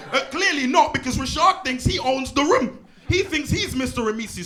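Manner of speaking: loud, high mock outburst through laughter; teacher shouting that Rashad thinks he owns the room. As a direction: blow up at the class, half laughing.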